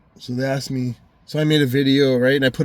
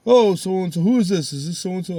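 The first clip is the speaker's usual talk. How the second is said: imitated police caller; 7 semitones higher, 4 dB louder than usual. Voicing police caller on phone.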